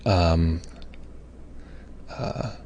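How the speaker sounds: ordinary talk, nothing marked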